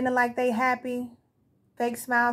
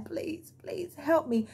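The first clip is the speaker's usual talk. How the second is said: mock begging; 5 semitones higher, 5 dB quieter, much slower than usual. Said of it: "begging them".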